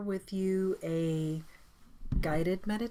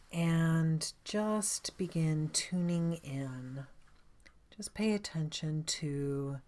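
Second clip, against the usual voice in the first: guided meditation; 3 dB quieter than usual. Acted. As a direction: guide them gently inward, leaving space after each phrase.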